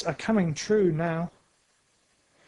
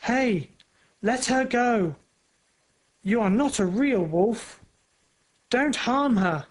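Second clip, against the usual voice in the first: yelling; 5 semitones higher than usual. A narrator's reading. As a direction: yell at the wolf to stop. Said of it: "he yells".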